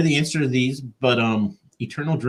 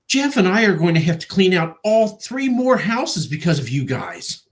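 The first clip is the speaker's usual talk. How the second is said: higher-pitched imitation of a fed-up nephew; mock exasperated complaint; 6 semitones higher, 3 dB louder than usual. Nephew griping to his uncles, mock exasperation. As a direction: complain emphatically, as retold.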